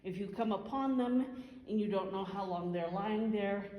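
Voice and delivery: usual speaking voice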